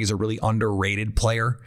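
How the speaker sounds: usual speaking voice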